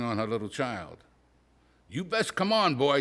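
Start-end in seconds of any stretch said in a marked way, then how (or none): 1.9-3.0 higher, louder scolding call; yelling mother. Acted. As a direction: call the boy over sharply. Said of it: "she yelled".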